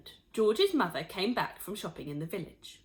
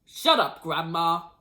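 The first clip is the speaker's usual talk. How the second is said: louder, curt; snappish; 3 semitones lower, 6 dB louder, slower than usual. A narrator's reading. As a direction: snap at the old woman. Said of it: "he said".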